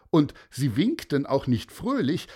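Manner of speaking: usual speaking voice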